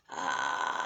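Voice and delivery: deep ugly crackling fry on ah; vocal fry. Shown as a demonstration. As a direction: push out a low rattling ah. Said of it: "start with this very ugly sound".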